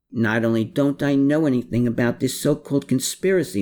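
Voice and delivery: mildly indignant, hurried protest; defensive. A narrator's reading. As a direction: deny it with some defensiveness. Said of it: "again with some defensiveness".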